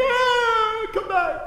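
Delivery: high, loud "ah, come back"; snatch the words back in panic; panicked yelp. Retold or imitated. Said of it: "And you're like".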